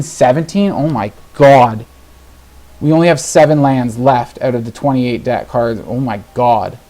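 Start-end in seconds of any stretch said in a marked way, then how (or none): none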